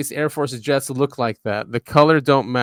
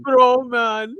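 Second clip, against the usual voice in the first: higher mock Canadian "Bro, man"; Canadian accent; 10 semitones higher than usual. Cohost quotes the miner in a mock Canadian accent. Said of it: "He said".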